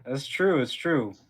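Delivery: usual speaking voice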